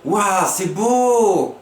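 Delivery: high, slow, drawn-out wonder; amazed wow. Shown as a demonstration. Teacher showing amazement for an exclamation. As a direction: marvel at something lovely. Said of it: "Il crie".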